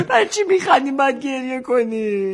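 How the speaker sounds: high-pitched tearful wail, dragging at the end; hammed-up crying complaint; mock tearful. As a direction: whine it like a comic sob.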